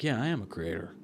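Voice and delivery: low, soft stoned mumbling to oneself; mildly dazed, hushed self-affirmation; imitated stoned agreement. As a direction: play lightly high, quietly convincing yourself. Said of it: "just high as hell".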